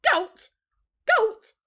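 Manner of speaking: high, loud, punchy exclamations; outraged one-word echo; appalled disbelief. A narrator's reading. Pirates appalled at goat stew.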